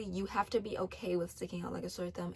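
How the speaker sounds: ordinary talk, nothing marked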